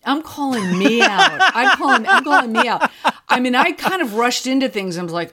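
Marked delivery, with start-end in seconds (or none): 0.1-2.9 laughing through the words, a bit louder. Real, not acted. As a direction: laugh at yourself while admitting it. Laughing at her own rushing.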